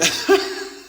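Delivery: high, airy chuckle; short laugh. Real, not acted. Recalling trying it one time, short high laugh. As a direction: laugh at your own attempt.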